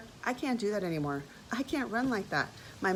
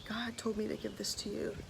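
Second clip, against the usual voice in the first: whispered; 2 semitones higher, 8 dB quieter than usual. Retold or imitated. Friend passing on God's message, whispered. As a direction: whisper it, barely voiced. Retold gift, emotional moment.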